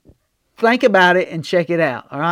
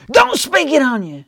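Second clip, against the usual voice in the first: scolding relative; 9 semitones higher than usual. Retold or imitated. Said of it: "they'd be like".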